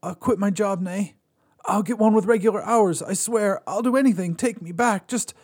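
put-on frantic begging ex-boyfriend; desperate pleading man. A narrator's reading. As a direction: beg her to take you back. Joel pleads with his ex.

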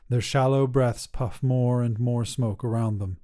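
ordinary delivery

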